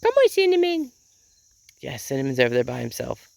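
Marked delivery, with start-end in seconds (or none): 0.0-0.9 loud, very high pet call; calling a chicken. Real, not acted. Owner calls Cinnamon back to the others, high coaxing call. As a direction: call the bird over in a high squeaky voice.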